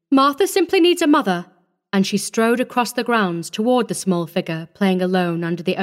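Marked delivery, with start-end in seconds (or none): 0.1-1.5 higher-pitched, emphatic assertion; firm insistence. A narrator's reading. Visitor overruling the doubtful matron, firm insistence. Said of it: "squared her shoulders firmly".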